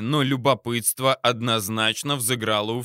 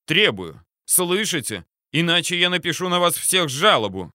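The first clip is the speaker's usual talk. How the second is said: heated demand; 6 semitones higher than usual. A narrator's reading. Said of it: "распалялся Сливко".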